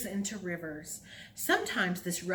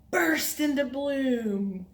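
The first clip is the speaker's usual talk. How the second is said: excited; 4 semitones higher, 5 dB louder, slower than usual. Shown as a demonstration. Flower bursting open game.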